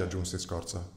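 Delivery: usual speaking voice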